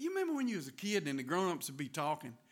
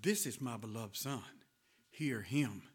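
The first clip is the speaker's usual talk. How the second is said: softer, slower and lower, tender and sincere; the way God said it; 6 semitones lower, 4 dB quieter than usual. Shown as a demonstration. Preacher showing how God truly spoke over his son, softly. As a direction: speak it gently like a loving father. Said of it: "that ain't how he said it".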